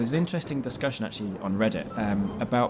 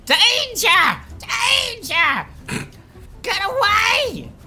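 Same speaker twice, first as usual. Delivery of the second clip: high screeching bird voice; shrill screeching bird woman; 6 dB louder, much slower than usual. Acted. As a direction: shriek the warning like a crow. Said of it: "it was going".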